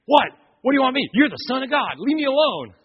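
loud, high, agitated demon cry; demon protesting. Retold or imitated. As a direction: shriek it like a cornered demon. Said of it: "the demon in him says".

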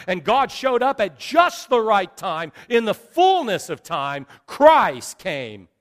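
loud, emphatic preaching; preaching at full force. Real, not acted. Preacher explains why the cross is the center of time, full-force preaching. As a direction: punch each phrase out to the congregation.

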